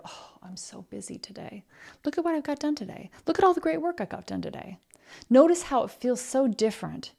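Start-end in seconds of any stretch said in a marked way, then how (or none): 0.0-1.6 breathy whisper of "busy"; mock the old excuse under your breath; whispered mock complaint. 2.0-4.8 higher and faster, proud; upbeat self-praise. Acted.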